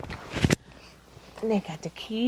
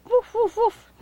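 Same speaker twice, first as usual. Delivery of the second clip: high-pitched pretend woofs; little high doggy woofs for a child; playful doggy bark; 10 semitones higher, 3 dB louder than usual. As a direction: bark like a toy dog in a high voice. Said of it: "We have the doggy".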